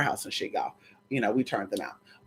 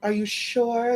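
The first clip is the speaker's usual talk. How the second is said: soft, higher coy voice; coy refusal; 6 semitones higher, 6 dB quieter, much slower than usual. Retold or imitated. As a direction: play shy and hesitant, quiet. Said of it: "And I'll say".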